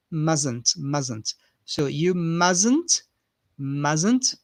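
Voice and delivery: "mustn't" said as musn't, no t; repeated "mustn't" without the t; silent t mustn't. Shown as a demonstration. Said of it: "Notice that the T is not pronounced".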